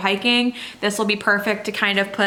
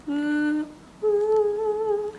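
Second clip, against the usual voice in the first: delighted ooh; 11 semitones higher, 4 dB quieter than usual. Real, not acted. Admiring colorway name.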